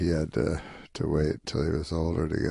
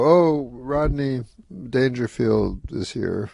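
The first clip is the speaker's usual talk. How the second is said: drawn-out announcement, pitched a little high; Lauren; 6 semitones higher, slower than usual. Retold or imitated. Announcing a guest to the show's staff, slow and drawn out. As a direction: stretch it out, starting high on the first words. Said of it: "Lauren would just go".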